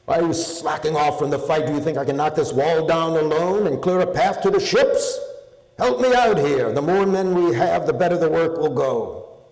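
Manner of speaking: loud, high rallying shout; a warlord's angry rallying cry; calling out to troops. Acted. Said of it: "he called to his godlike Lycians".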